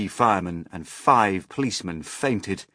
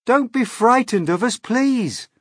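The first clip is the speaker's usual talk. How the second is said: put-on high, loud boyish plea; calling out; 9 dB louder than usual. A narrator's reading. James reassuring the crowd below, calling out. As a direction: call down to calm them. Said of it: "James called out".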